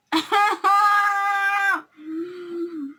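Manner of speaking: high, loud shrieking laugh; loud laughing shout. Real, not acted. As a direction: crack up at full volume. Laughing at the story.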